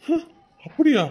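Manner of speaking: a sudden loud, high "really?"; surprised. Acted. Uncle hears the mother already wished her son, surprised loud.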